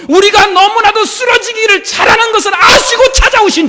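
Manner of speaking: rising passionate sermon shout; preaching shout. Real, not acted. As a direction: build to a full shout. Preaching climax.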